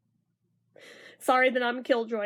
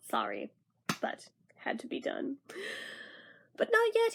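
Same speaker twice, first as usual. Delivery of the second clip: ordinary delivery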